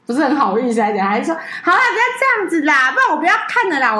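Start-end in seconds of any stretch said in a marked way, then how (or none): 1.6-4.0 higher, fast mock coaxing; coaxing professor. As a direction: imitate him giving in, pleading a little. Retelling professor coaxing her.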